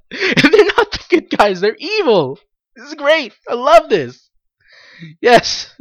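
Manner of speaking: giggling fan joy; laughing excitedly. Real, not acted. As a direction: laugh through the words with glee. Laughing excitedly over the show.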